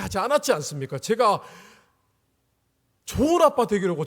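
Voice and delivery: re-enacted indignant prayer; arguing with God. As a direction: plead your case loudly and insistently. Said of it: "하나님께 막 따졌습니다".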